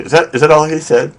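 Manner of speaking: puzzled, high-pitched double-check; incredulous question. Retold or imitated. Acts out his puzzled reaction.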